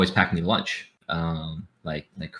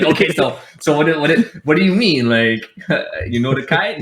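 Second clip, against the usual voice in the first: higher, louder, baffled pleading; exasperated confusion; 7 semitones higher, 4 dB louder than usual. Retold or imitated. Pressing his Hawaiian friend, higher, louder and baffled. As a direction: push the question, half laughing.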